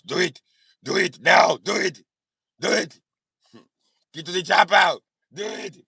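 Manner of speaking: put-on thick Austrian-accented action-hero orders; Arnold Schwarzenegger accent. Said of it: "doing an Arnold Schwarzenegger accent".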